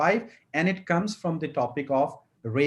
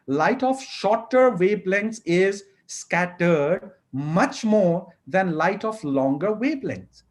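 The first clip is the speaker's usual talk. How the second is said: slow careful reading; much slower than usual. Shown as a demonstration. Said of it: "ठहराव के साथ पढ़ना है".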